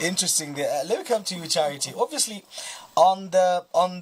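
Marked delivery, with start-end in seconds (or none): none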